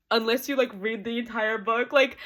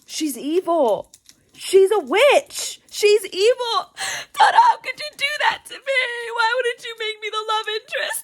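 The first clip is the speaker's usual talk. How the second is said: outraged wailing; 9 semitones higher, 8 dB louder than usual. Real, not acted. Reader betrayed by Miss Amina, outraged wailing. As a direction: build from loud accusation into a high wail.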